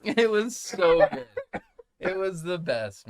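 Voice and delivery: high, slow gush through laughter; real laughing. Real, not acted. Dad delighted by kid's rock, laughing as he talks. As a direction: laugh through the words, stretched and loud. Still cracking up.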